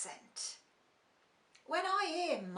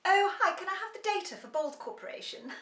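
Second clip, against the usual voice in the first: higher, louder posh office greeting, then a quick request; posh work voice; 6 semitones higher, 5 dB louder than usual. Retold or imitated. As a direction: put on a polished corporate voice. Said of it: "at work I'm all".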